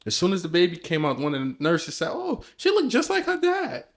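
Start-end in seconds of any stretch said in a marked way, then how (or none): 2.1-3.8 high squeaky imitation of a nurse; mock nurse voice. Retelling the nurse at the birth, high mock voice. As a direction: chirp it up high like a cheerful nurse.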